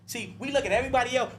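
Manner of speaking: usual speaking voice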